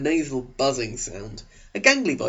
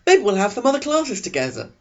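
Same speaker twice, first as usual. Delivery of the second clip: put-on higher, hopeful teen boy voice; hopeful Eric; 8 semitones higher, 3 dB louder than usual. Eric at the classroom door, hoping to see Bella again, high and hopeful. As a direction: lift it high with a shy hope. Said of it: "He sounded hopeful".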